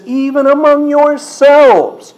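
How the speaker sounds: high, loud, drawn-out preacher's warning; emphatic warning. Retold or imitated. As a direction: cry out the warning, stretched and forceful. Preacher voices Paul's warning to elders.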